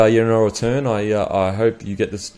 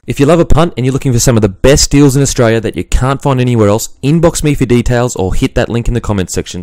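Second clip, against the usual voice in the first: ad read; 10 dB louder than usual. Acted. Sponsor ad read.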